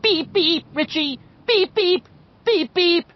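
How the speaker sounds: put-on nose held, high and loud boyish beeping. A narrator's reading. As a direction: pinch the nose and squeal like a kid. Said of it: "held his nose and cried in a high, quavering, boyish voice".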